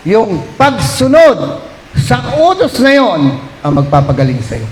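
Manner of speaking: slow, deliberate stress; slow, weighty preacher line; slow emphasis. Acted. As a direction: draw out each word. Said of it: "slow emphatic preaching".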